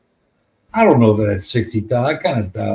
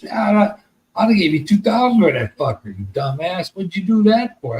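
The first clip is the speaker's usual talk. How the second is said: friend scolding; 7 semitones higher than usual. Retold or imitated. Friend scolds him over the sale.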